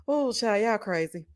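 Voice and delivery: usual speaking voice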